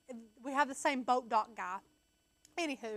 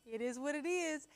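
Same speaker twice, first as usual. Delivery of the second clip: mock calm shrug; 2 semitones higher, 6 dB quieter than usual. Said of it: "He's just like".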